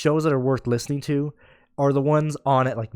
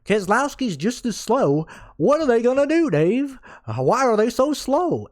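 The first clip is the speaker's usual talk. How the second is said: mocking a caller; 9 semitones higher than usual. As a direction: whine like a fed-up superfan calling in. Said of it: "reenacting how he was talking".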